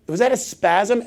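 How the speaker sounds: ordinary delivery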